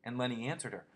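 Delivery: ordinary delivery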